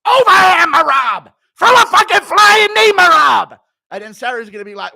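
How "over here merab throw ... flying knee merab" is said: frantic high-pitched corner scream; Matt Serra impression. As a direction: yell instructions at full volume from the corner. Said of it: "screaming in Matt Serra's voice".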